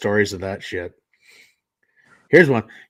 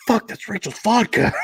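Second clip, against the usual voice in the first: startled wife; 11 semitones higher than usual. Retold or imitated. Wife takes drink.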